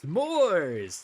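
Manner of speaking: loud, high drawn-out reveal; announcer's name call. Real, not acted. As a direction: belt out the contender's name.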